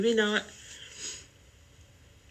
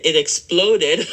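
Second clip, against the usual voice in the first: real laughter breaking through the words, much louder; laughing; 10 dB louder than usual. Real, not acted. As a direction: blurt it out while cracking up. Laughing at exploded dye bottle.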